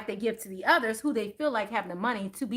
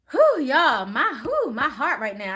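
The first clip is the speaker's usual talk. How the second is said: out of breath; 3 semitones higher, 6 dB louder, slower than usual. Real, not acted. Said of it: "I need to catch my breath".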